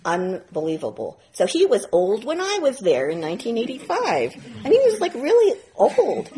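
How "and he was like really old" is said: put-on really old. Exaggerating teacher's great age.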